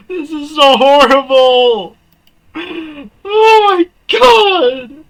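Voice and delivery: high loud wailing cry, very slow; laughing shriek. Real, not acted. Gamer shrieking at the horrible scene. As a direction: howl it out.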